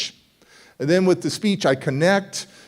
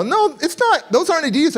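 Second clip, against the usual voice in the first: high, jeering taunt; mocking bullies; 11 semitones higher than usual. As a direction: taunt him like a smug upperclassman. Upperclassmen mocking shoes.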